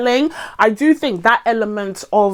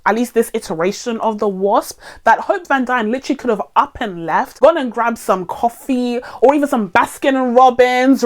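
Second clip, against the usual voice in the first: usual speaking voice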